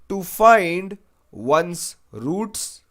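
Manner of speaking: very slow, drawn-out "to find one's roots"; slow option reading. Shown as a demonstration. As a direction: stretch every word of "to find one's roots" for the class. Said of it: "teacher reads option slowly".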